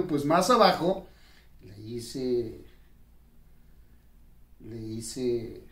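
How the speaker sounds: ordinary talk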